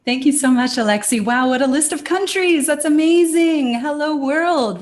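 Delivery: excited greeting. Real, not acted. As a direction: greet the whole world with real delight. Enthusiastic greeting to worldwide audience.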